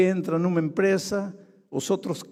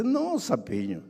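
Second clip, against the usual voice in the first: higher, coaxing scorpion; a sly scorpion pleading innocence; scorpion's voice; 8 semitones higher, 4 dB louder than usual. Acted. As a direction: sweet-talk the frog into trust. Scorpion reassures frog in fable.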